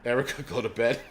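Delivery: breaking into a laugh; amused, ending in real laughter; laughing dismissal. Real, not acted. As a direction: tease her through laughter. Laughing at the critic.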